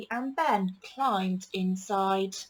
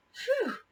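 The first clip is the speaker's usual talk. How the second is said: high breathy relieved sigh; relieved phew. A narrator's reading. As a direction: blow out the air, glad it is over. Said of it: "said Katy".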